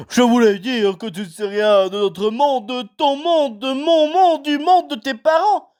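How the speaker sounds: big gruff giant pitched high and slow; Hagrid voice. A narrator's reading. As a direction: play the kindly giant, unhurried. Hagrid voice held.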